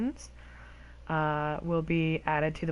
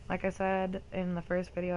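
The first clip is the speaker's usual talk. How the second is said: ordinary talk, nothing marked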